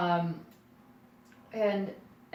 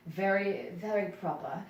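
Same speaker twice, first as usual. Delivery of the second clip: light RP, dropping the final R; touch of Shakespearean RP; received pronunciation. Shown as a demonstration. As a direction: demonstrate proper British RP. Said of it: "received pronunciation".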